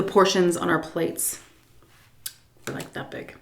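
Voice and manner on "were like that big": hushed whisper; barely voiced, hushed; whispered aside. Real, not acted. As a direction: drop to a whisper as if sharing a secret. Whispered aside about tiny portions.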